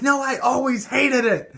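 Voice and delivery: high, loud, slow imitated snap of a mother; curt mom retort. Mother contradicting her child, high loud slow mock retort. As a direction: play mom flatly denying she ever liked it.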